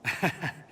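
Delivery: a brief amused chuckle; one quick laugh at the joke; short dry laugh. Acted. Amused at the remark.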